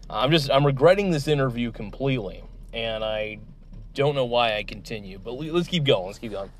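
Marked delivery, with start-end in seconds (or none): none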